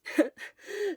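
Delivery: quick breezy laugh; light laugh. Acted. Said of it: "Hisashi laughed lightheartedly".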